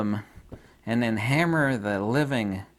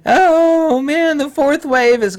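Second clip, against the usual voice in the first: high, loud mock panic, much higher; mocking fearful woman; 10 dB louder, slower than usual. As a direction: ham up a frightened official crying doom. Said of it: "was fear-mongering".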